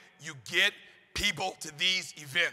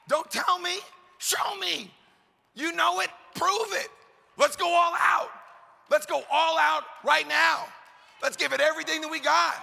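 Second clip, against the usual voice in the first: fired-up shout; 8 semitones higher than usual. Real, not acted. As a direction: whip the crowd into a frenzy. Preacher fires up the crowd.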